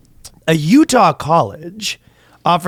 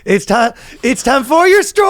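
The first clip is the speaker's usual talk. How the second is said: much higher hammed-up shout; mock parent; 10 semitones higher than usual. Dad mocking a pushy parent, shouting.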